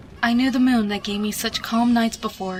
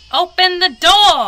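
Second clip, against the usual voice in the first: much higher, much louder, slow booming demand; monster roaring; 9 semitones higher, 10 dB louder, slower than usual. A narrator's reading. Monster pounding on the filly's door, roaring. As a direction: bellow it through the door like a monster. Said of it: "The voice of the monster echoed".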